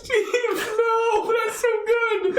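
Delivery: very high, helpless laughing voice; cracking up. Real, not acted. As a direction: squeal it through laughter. Cracking up at the joke.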